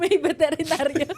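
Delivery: high, loud and giggly; amused denial. Retold or imitated. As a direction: laugh through the last excuse. Punchline of retold denial, laughing.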